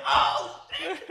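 high, loud startled gasp of surprise; shocked oh shit. Retold or imitated. Kid spotting his GameCube, shocked. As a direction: blurt it out in sudden shock.

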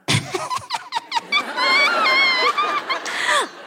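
high laughing shout of joy; shrieking laugh of victory; triumphant laughing whoop. Retold or imitated. Triumphant celebration.

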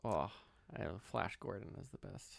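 ordinary talk, nothing marked

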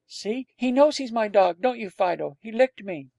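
put-on high-pitched young boy pleading his case; lightly voiced eager boy. A narrator's reading. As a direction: show off your dog proudly.

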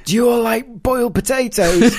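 high, loud, silly cheery offer; hammed-up high-pitched comic visitor; mock English newcomer. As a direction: pipe up far above your voice. Plays English arrivals pestering locals.

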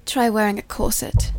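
posh British accent demo; Keira Knightley. Shown as a demonstration. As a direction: put on an English film-star accent. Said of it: "I can do a Keira Knightley accent".

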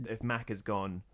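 ordinary talk

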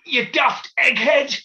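high, slow furious yell; angry shout. Retold or imitated. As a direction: shout it out the car window. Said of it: "the bloke wound down the window and went".

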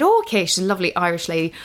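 bad Irish lilt, lightly put on, as a lovely Irish lady; a light hammed-up Irish midwife accent; terrible Irish accent. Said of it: "Terrible Irish accent".

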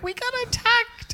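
very high, squealed mock alarm; retold shocked announcement. Telling friends about the attack, retold shocked squeal. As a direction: squeak out the dramatic news.

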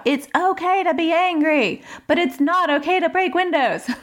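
high-pitched mock shout; retold shout. Mother shouting at son about the window, high mock yell. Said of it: "So I shout".

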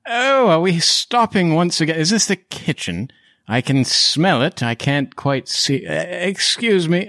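put-on high, befuddled old gentleman; doddering Van Richten. Lost man asks party for his glasses, doddering.

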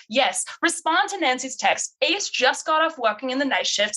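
badly faked accent for Bess, rambling dictation; Bess's put-on accent. Bess dictating a reply to her phone, in a put-on accent. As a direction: channel Bess's vibe with a shaky accent attempt. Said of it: "That accent is the worst".

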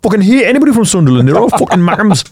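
high, fast mock Geordie grumble; Geordie rant. Mocking Newcastle fans who hate Sunderland, fast Geordie rant. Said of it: "That's Newcastle".

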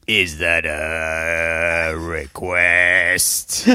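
very slow low crooning DJ; Ivor Davies. Retold or imitated. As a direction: drag it out like a late-night crooner. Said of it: "and then Ivor Davies goes".

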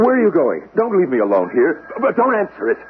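high, frantic begging; don't leave me, pitch climbing; desperate, rising plea; panicked pleading. Acted. Begs butler not to leave.

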